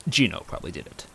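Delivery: plain reading voice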